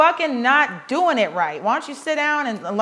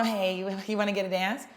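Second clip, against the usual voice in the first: lower, much softer, blunt sales ask; mock the girl who skips the small talk; pushy blunt pitch; 3 semitones lower, 9 dB quieter than usual. Dancer rushing straight to selling a dance, soft and blunt. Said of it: "Not walking".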